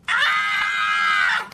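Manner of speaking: loud, low mock scream of fright. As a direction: yelp like scared parents. Parents scream at ending.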